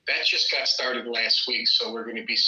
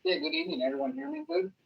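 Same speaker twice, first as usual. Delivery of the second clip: muddy voice; 6 dB quieter, faster than usual. Real, not acted. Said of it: "you sound a little muddy".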